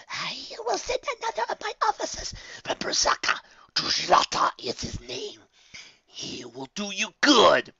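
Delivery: a looming shadow letting out its disappointment; disappointed rasping entity; sighing dark voice. A narrator's reading. Dark master names new officer.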